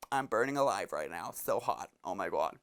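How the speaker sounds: low, unimpressed mock teen drone; teenage girl imitation. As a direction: drop low, selfie-girl nonchalance. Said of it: "these teenage girls".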